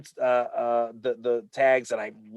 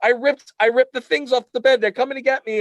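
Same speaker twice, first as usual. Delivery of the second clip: put-on high, loud frightened child's voice; panicked kid; 13 semitones higher, 6 dB louder than usual. Boy confessing to mom he ripped the tags, high and frightened. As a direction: cry out like a terrified kid. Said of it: "And I was like".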